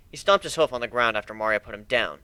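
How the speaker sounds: ordinary delivery, nothing marked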